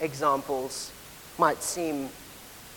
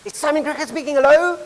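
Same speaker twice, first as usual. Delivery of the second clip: high singsong phone greeting, louder on hello; child answering phone; 9 semitones higher, 6 dB louder, faster than usual. Retold or imitated. Younger self wins the race to the phone, eager high greeting. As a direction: rattle off a childish telephone hello, rising at the end. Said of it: "I'd pick up the phone".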